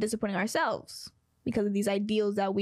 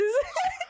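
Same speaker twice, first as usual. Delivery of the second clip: high angel-like giggle; breaking character giggling. Real, not acted. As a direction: squeal with helpless laughter. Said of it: "My angel noises".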